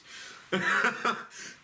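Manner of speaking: high airy giggle; high laugh. Real, not acted. Comic laughing at his own joke. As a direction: burst into laughter.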